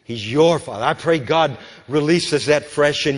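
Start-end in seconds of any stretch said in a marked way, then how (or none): 0.0-0.8 "your" hit firmly, falling after; stress on YOUR. Shown as a demonstration. Preacher repeats it to listeners, stressing "your". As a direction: punch "your" to drive it home. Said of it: "He's not just the Father".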